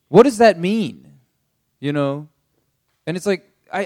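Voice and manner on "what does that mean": mock confusion. We mimic confusion at Jesus.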